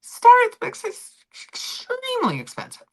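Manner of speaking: high, put-on voice; mock posh voice. Streamer mimicking a shallow royal to chat, high mock voice. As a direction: lift the voice way up to mock.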